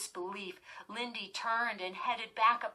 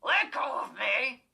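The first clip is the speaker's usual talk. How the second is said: loud, rumbling snarl of a dummy; the evil dummy snarling back at the girl; raspy snarl; 4 semitones higher, 7 dB louder than usual. A narrator's reading. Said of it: "he snarled in his raspy, deep rumble".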